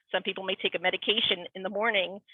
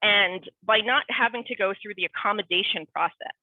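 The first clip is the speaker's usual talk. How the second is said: usual speaking voice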